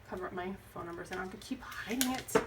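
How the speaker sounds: quick whispered aside; whispering. Real, not acted. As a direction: whisper it under your breath, fast. Hiding phone number, muttering aside.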